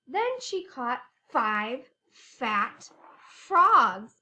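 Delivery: slow, with "frogs" burst out loud; stressing f words. Shown as a demonstration. Teacher stressing f words for kids. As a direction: build slowly, then punch "frogs" loud.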